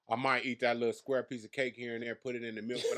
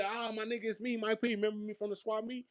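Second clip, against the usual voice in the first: much higher, eager mock greeting voice; imitating Mike P; 11 semitones higher than usual. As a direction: squeak it out like an overeager acquaintance.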